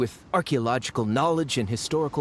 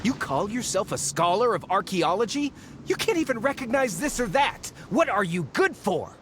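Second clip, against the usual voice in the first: biting, contemptuous questions pitched up; scornful scolding; 6 semitones higher than usual. Acted. Scholar scolds the archaeologist during their argument, scornful. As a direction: berate the so-called scholar. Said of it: "Oh, stop arguing!".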